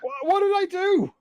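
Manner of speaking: very high mock-innocent whine; high, incredulous protest; wounded protest. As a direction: plead innocence, voice shooting up. Protesting in a teasing quarrel.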